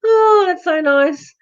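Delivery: high, slow, delighted gush; beaming at a kind viewer. Real, not acted.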